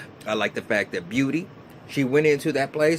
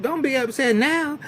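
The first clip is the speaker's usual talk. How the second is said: mock taunting; 12 semitones higher than usual. Retold argument, taunting.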